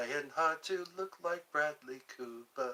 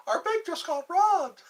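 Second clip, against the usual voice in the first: very high, slow sing-song; mock chirpy announcement, higher and slower; oblivious customer; 7 dB louder than usual. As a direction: stretch it out cheerfully clueless. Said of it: "That's how they say it too".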